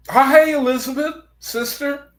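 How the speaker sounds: light up to greet a friend arriving; high-pitched delighted hello to a sister; excited greeting. Real, not acted. Greeting a viewer joining stream.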